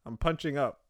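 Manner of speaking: ordinary delivery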